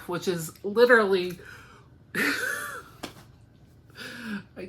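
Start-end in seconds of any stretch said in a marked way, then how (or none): 2.1-2.8 high giggle. Real, not acted. Laughing mid-sentence.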